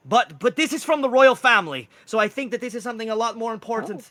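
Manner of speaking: high, loud, hurried Welsh insistence; harsh Welsh accent. Acted. Mushroom man stresses the royal family's note, insistent Welsh accent. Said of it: "He has this harsh Welsh accent".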